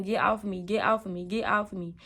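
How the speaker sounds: ordinary talk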